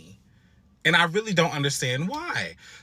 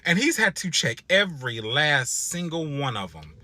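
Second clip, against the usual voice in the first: ordinary talk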